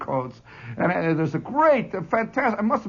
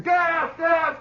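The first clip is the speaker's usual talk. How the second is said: mock yell; 12 semitones higher than usual. Lieutenant yelling gas again.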